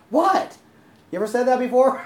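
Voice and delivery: indignant, high-pitched loud "what"; irritated outburst with a big upward leap. Acted. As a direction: fire it out in disbelieving annoyance. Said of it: "So he's irritated".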